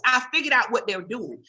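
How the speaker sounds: normal talking voice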